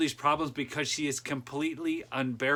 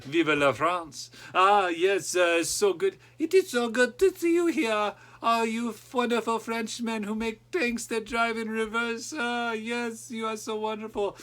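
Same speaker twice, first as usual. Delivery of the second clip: a hammed-up French accent, slow at first; a lightly put-on French accent, gushing; playful fake French accent, flattering the French; mock French accent; 7 semitones higher than usual. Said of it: "I don't even know if I could do a French".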